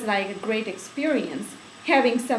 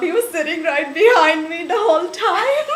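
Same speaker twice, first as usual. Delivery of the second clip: higher, louder, fast and breaking into laughter; laughing; 10 semitones higher, 8 dB louder, faster than usual. Real, not acted. Telling the interviewer about the boy behind her, laughing. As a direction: laugh through the punchline.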